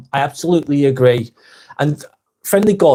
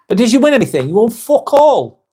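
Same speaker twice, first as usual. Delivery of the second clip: exasperated rant; 7 semitones higher, 3 dB louder than usual. Real, not acted. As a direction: hammer home the scorn. Ranting at football fans.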